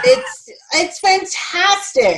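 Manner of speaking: high, slow gush of delight; drawn-out rave. Real, not acted. Cohost raving about the movie's explosion, slow and high. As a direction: stretch out fantastic with glee.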